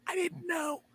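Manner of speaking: high, soft sheepish squeak; sheepish Hutch. Acted. Hutch admits his mistake to Fish, sheepish. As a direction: squeak it out embarrassed. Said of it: "he's like".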